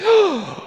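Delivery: sharp high gasp of horror; shocked gasp. Retold or imitated. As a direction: gasp like you just opened the junk drawer. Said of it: "deep breath of shock and horror".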